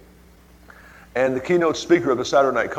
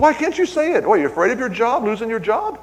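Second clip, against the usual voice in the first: higher, needling questions; mocking challenge; 10 semitones higher than usual. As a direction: taunt her, pitched up. Pressing secretary, mocking.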